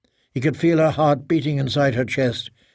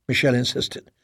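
ordinary delivery

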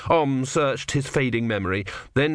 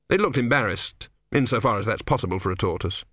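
usual speaking voice

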